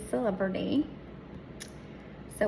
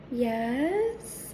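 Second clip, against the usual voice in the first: normal talking voice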